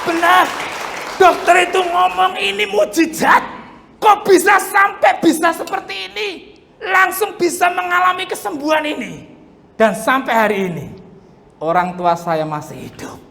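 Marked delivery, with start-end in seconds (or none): 0.1-9.3 preaching at full force. Acted. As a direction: proclaim the miracle with full force. Testimony climax, shouting.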